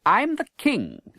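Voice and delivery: high, slow, a hint of royal pride; proud young king. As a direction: insist on who he is. Edward asserts himself.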